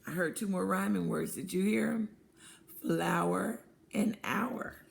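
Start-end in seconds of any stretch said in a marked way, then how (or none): none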